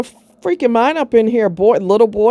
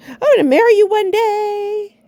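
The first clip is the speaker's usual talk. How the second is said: high, whiny mock lover's promise; mock sweet-talking scammer; 13 semitones higher than usual. Mocking romance scammers' promises to women, high and whiny. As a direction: sneer at a scammer's fake vow, pitched up. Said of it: "I don't fall for fluffy words".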